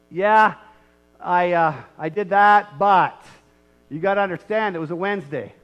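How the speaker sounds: loud, hammed-up excuse-making; mocking a sinner minimizing their fault. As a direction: justify yourself, laying it on thick. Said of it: "we try to minimize".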